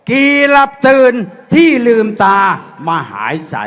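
rising, declaiming verse to a crowd; poem recitation. Acted. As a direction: recite it like a stirring rally poem.

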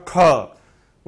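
ख with a strong puff of breath; aspirated kha. Shown as a demonstration. Teacher demonstrating ख with a strong breath push. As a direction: push extra air through ख. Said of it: "ज्यादा जोर लगाना पड़ेगा ख".

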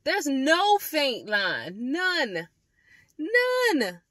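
shocked, almost shouting at the result; shocked disbelief. Real, not acted. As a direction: press it on them in disbelief. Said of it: "Look at this shit".

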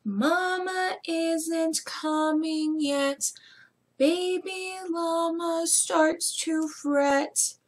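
lilting storybook reading; reading to children. Acted. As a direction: read it like bedtime story time. Reads the story aloud to children.

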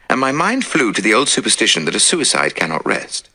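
plain reading voice

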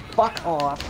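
real shouted curse; angry shout. Real, not acted. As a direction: yell it at an attacker. Swearing at enemies in game.

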